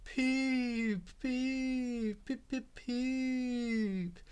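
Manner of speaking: high, drawn-out eerie peeps; ghostly shriek. A narrator's reading. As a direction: wail it like a ghost engine. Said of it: "he shrieked".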